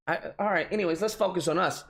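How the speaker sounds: normal talking voice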